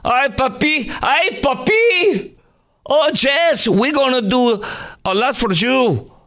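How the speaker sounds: very high, syrupy voice cooing at a puppy; mocking Kamala Harris. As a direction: coo it high and sugary like talking to a pet. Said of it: "she talked like this".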